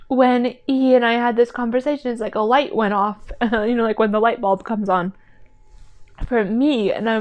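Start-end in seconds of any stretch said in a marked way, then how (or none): none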